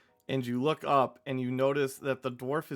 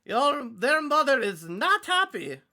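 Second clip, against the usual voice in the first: high shrill goblin scold; scolding goblin wife; 10 semitones higher than usual. Acted. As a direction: nag him like a goblin wife.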